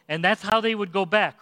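ordinary delivery

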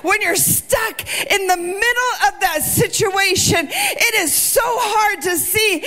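impassioned preaching. Acted. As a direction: preach it out, climbing toward the peak. Preacher builds to full force.

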